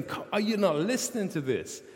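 high, insistent, exasperated; exasperated God. Retold or imitated. God rebuking the hesitant Moses, exasperated and insistent. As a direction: press it home with frustration. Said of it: "God's anger burns against him".

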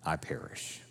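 soft whispered acceptance of fate; whispered resolve. Acted. Esther accepting her fate, a hushed whisper. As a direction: let it fall away into a whisper.

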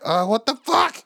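high, slow exasperated groan of words; frustrated outburst. Acted. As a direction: draw out the exasperation. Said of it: "naturally you're frustrated".

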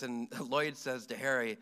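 normal talking voice

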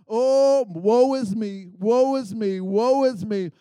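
loud, drawn-out mock lament; big, loud poor-me moaning; mock self-pity. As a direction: wail a slow, hammed-up woe-is-me. Mock self-pitying wail in a sermon.

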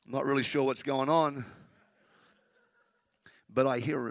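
a huge deity peering around for a squeak; God as a bemused giant. Pretend. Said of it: "And God's like".

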